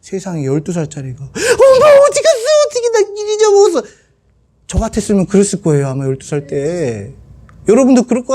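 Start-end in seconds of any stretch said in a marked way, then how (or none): 1.2-3.8 put-on very high, crying child voice, fast; mock wail of a lost kid; whining child. Imagined twelve-year-old crying for his mom, high and whiny.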